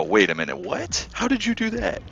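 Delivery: rising to squeaky disbelief; mock amazement. Players watching a show-off, mock amazed squeak. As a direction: act the dumbfounded onlooker, pitch leaping up. Imitating impressed onlookers.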